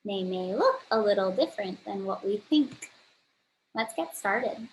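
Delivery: ordinary talk, nothing marked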